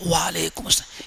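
unvoiced whisper, barely audible. Companion answering so softly the Prophet cannot hear. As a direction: breathe the words so they go unheard. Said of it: "اتنے آہستہ سے کہا".

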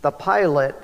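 ordinary talk, nothing marked

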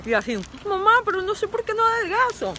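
high, loud imitation of a whining daughter; whiny daughter. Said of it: "donde me decía".

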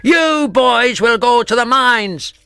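high, loud, slow furious sentence; explosive rage. Acted. Grimlard sentences the boys, slow furious roar. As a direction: hammer out the punishment. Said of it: "ready to explode".

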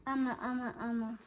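mumbled syllables through a held snout; muffled attempt to speak. A narrator's reading. Fuzz's snout is grabbed, muffled speech. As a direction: try talking with your mouth held shut. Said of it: "Fuzz tried to speak".

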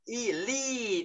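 Elite said high with the i vowel stretched long. Shown as a demonstration. Teacher shows the long vowel sound to students. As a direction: draw the vowel out. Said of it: "y dài kéo ra nhá".

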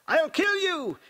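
high, loud raving threat. A narrator's reading. Possessed Aaliyah hurling hideous curses, raving. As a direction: shriek it like one possessed. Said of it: "Still, Aaliyah raved".

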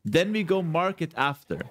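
ordinary talk, nothing marked

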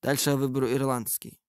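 ordinary talk

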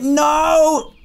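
high, loud no; sharp high protest; frustrated cry. Real, not acted. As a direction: wail at the failed jump. Missed the jump.

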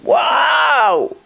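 high startled double wow; retold shout. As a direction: cry out at the sight of the number. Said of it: "he gave a shout, he screamed".

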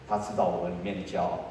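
ordinary talk, nothing marked

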